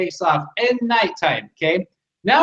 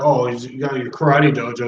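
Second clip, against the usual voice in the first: mocking; 6 semitones lower than usual. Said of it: "mocking everything about it".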